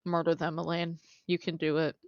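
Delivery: soft deadpan command to murder; calm, soft encouragement to kill. Pretend. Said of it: "can you tell me to murder them".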